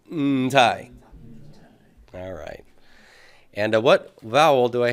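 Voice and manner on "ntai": "Ntai" with a held, hummed n; modelled pronunciation. Shown as a demonstration. Teacher leads class in saying it, "Ntai" with lengthened n. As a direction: lean on the n of "Ntai" before the tai. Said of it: "say that with me".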